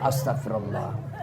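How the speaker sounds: low breathy whisper; whispered istighfar. Shown as a demonstration. Young man seeing a pretty girl, whispering istighfar. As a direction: whisper it under your breath. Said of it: "Kau lihat cewek cantik".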